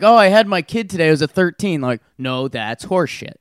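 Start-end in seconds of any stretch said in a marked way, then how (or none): 0.0-1.8 higher, louder, fast gushing; mock excited. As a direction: gush like a proud new parent. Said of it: "you can't be like".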